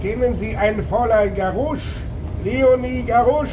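detective calling after a witness leaving for her bus. Acted. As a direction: shout the questions after her. Detective calls out questions in street.

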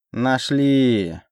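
drawn-out pleased exclamation; lightly voiced delighted villain; gleeful wheeze. A narrator's reading. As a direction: gloat over the find. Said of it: "обрадованно засопел он".

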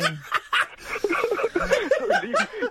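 long high-pitched laugh. Real, not acted. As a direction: burst into a high giggle. Caller laughs at the joke.

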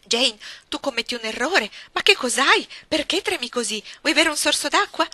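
voce più alta e forte, tono ansioso da zia; signora Reed turbata. A narrator's reading. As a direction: cerca di calmare la bambina, allarmata. (zia spaventata che tenta di calmare Jane, agitazione)

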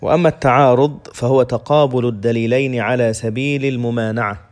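normal talking voice

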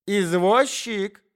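протяжно, громко и высоко зовёт извозчика; крикнуть через улицу, растягивая слово; оклик извозчика. Acted. (громкий протяжный оклик извозчика)

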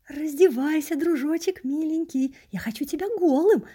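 high, syrupy coaxing; affectionate, lilting hunter voice; tender predator. A narrator's reading. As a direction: coo the order sweetly, like a doting tormentor. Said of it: "ласково сказал мне охотник".

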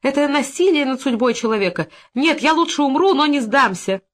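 high, melodramatic protest; theatrical high-pitched outcry; exclaiming. A narrator's reading. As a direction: declaim defiance like a tragic heroine. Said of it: "заламывая руки и восклицая".

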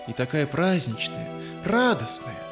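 usual speaking voice

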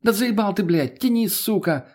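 strained, high irritated woman's voice; exasperated woman. A narrator's reading. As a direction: snap at a partner to pull. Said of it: "послышался с улицы голос Лены".

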